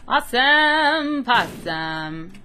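slow, loud and gleeful; excited cheer. Real, not acted. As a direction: draw the cheer out. Cheer, drawn out.

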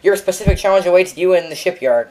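high, loud announcer read; hammed-up game announcer. Gamer reads out the unlock message, mock grand announcer. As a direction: proclaim it big, high and loud.

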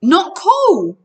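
high, loud yell. A narrator's reading. Grover tied to the vibrating bed, yelling. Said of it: "he yelled".

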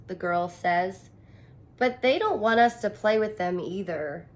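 ordinary delivery, nothing marked

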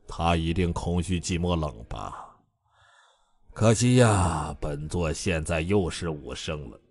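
low, soft, self-satisfied musing; smug inner voice. A narrator's reading. Bai Jianfei gloating to himself, in a soft smug voice. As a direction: savour the thought, preening quietly. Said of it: "白剑飞揉着自己肥厚的下巴，心想".

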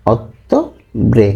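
অক্টোবরে very slowly as "otto", pause, "bre"; syllable-by-syllable pronunciation. Shown as a demonstration. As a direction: split অক্টোবরে in two for learners. Teacher breaks down Italian month name. (অক্টোবরে থেমে থেমে, ধীরে, ‘অত্তো—ব্রে’ উচ্চারণ)

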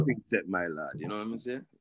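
put-on playful Jamaican Patois accent. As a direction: slip into Jamaican Patois, teasing. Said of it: "We should do the rest of it in Patois".

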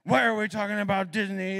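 mock Dad's grumpy complaint. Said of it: "she's totally making fun of me".

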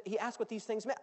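ordinary talk

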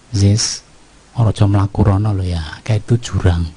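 low, easygoing fatherly caution; gentle warning. Pretend. Father to his son standing near a cliff, gently warning. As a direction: warn a child close beside you, mildly. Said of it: "Ngomongnya kan enak toh, kenapa deket?".

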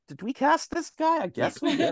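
a giggly, high-pitched crew member doubting the casting; baffled film crew. Retold or imitated. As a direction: play the puzzled crew, chuckling through it. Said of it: "they're like".

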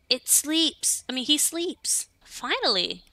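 excited relief. Real, not acted. Relieved the baby finally slept.

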